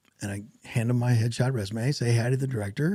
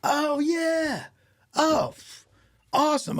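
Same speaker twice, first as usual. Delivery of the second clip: very high-pitched, drawn-out mock realization; excited recognition; 13 semitones higher, much slower than usual. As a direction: imitate someone thrilled as it clicks. Acting out director remembering the scene.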